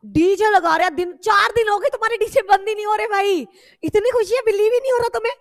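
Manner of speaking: loud, high burst of amazement; excited exclamation. Acted. Teacher playing up students' wild celebration, loud excited exclamation. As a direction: exclaim loudly, pitched well up.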